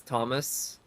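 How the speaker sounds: Thomas with a sounded final S; "Thomas", closing on an audible S; English pronunciation. Shown as a demonstration. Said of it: "With an S".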